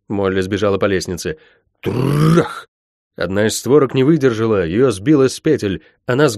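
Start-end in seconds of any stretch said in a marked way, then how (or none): 1.8-2.7 long rolled-r crash; trilled, higher-pitched bang; crashing noise. Acted. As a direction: imitate a door being rammed. Imitated battering ram crash.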